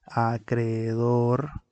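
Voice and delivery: "acreedor" said very slowly, the e and e apart; slow, deliberate example; slow demo word. Shown as a demonstration. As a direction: draw out "acreedor" for the class. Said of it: "Por ejemplo".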